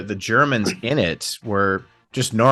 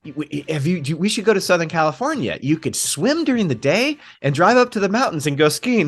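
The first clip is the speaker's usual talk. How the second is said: high, gushing wife imitation; hammed-up chatter, pitched up and excited; Sybil; 6 semitones higher than usual. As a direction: play an eager wife pitching a holiday. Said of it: "Sybil's talking to them and she's like".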